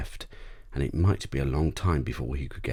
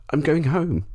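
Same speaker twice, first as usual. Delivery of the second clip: put-on boy's voice; 12 semitones higher, 5 dB louder than usual. A narrator's reading. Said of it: "said my father".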